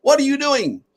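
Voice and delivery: mother calling out high and sharp, slowing at the end; a mock call across the café, pitched well up; mother yelling. Mother scolds Omar from her table, high mock call. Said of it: "I hear this woman yelling".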